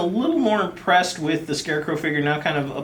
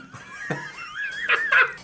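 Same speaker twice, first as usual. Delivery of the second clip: villain laugh; 4 dB quieter than usual. Pretend. Villain taunt ends in laugh.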